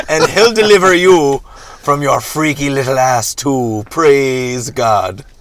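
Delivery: loud, high pulpit shout; hammed-up booming sermon climax; fiery preacher. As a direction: thunder it like a revivalist. Reverend preaching at the leprechaun.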